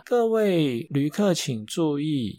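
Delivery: slow, formal PA announcer voice; theme park announcement. Retold or imitated. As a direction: read it like a park loudspeaker notice. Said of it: "那我又不是播报员". (慢速的游乐园广播腔)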